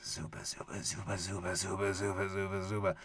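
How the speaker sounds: low, quiet alien chant; alien noise. Retold or imitated. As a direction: murmur it like a creature from space. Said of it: "that was my alien noise".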